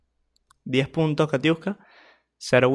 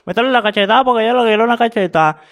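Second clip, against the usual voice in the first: loud, higher, rushed mock goading; imitated egging-on; 5 semitones higher, 6 dB louder, faster than usual. Commentator acting out who starts the slapping fight, loud hurried goading. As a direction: egg them on in an imitation of the instigator. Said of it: "Así como que".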